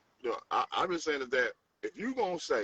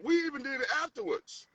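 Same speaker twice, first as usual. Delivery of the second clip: high admiring. Real, not acted. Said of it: "that was smart though".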